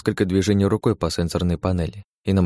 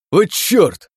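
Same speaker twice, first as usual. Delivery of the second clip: sudden loud curse; shouting; 12 semitones higher, 6 dB louder than usual. Acted. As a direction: yell it out in pain. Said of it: "заорал он".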